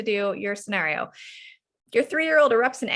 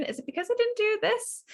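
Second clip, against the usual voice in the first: much higher, light questioning voice; gentle guessing mom; 13 semitones higher than usual. Shown as a demonstration. Mom guesses the cause of her child's anger, in a much higher, lightly questioning voice.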